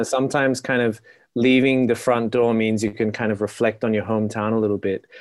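ordinary delivery

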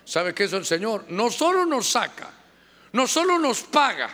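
usual speaking voice

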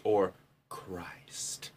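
a soft, breathy whisper. Acted. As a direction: whisper it like a secret. Wordplay repeated twice.

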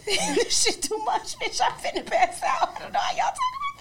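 high, laughing near-crying squeal; overwhelmed, near tears. Real, not acted. Listener finding the story too much, laughing near tears. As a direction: squeal through laughter as if about to cry. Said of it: "I'm finna cry".

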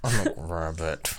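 put-on low, soft drunk mutter; lightly voiced drunk woman. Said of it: "the first thought she had".

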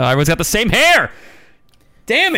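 loud, high mock cry of dismay; exasperated outburst. Retelling the dismay at everyone's haircuts, exasperated shout. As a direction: burst out in comic frustration.

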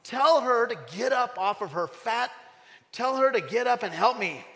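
high, loud whiny complaint; exasperated Martha. Retold or imitated. Martha complaining to Jesus about Mary, loud whiny protest. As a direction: whine it like the put-upon sister. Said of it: "she came to him and she said".

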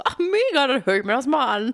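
eager fan. Retold or imitated. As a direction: gush like a thrilled follower. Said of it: "schreiben mir wirklich Leute so".

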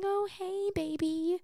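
very high, soft mock coo; hushed, high-pitched baby-talk greeting; girlfriend voice. Shown as a demonstration. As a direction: imitate a dude sweet-talking a partner. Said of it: "if a dude is talking to you like this and going".

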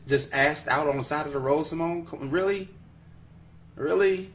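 high, disbelieving questions; incredulous. Real, not acted. Host reacting to Simone's roadside comment, incredulous.

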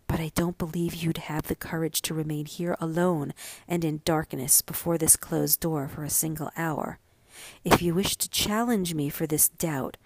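ordinary delivery, nothing marked

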